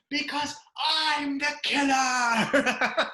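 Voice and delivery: very slow, high, laughing mock menace; hammed-up killer line. Retelling the movie actor's audition line, slow and campy. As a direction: drag it out as a campy villain.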